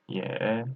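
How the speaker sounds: "Ye" low and dipping, third tone; soft "Ye" that dips then lifts. Shown as a demonstration. Teacher reading tones aloud, "Ye" in third tone. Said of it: "third tone".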